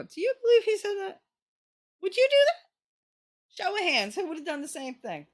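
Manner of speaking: high, loud, animated teacher voice; kindergarten teacher. Retold or imitated. Teacher asking kindergartners about the story, high and loud. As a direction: quiz little kids with big high excitement. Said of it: "I would stop and go".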